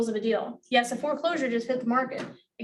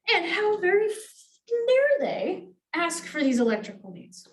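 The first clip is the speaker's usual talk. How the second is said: mock indignation; 7 semitones higher, slower than usual. Mocking wife's indignation.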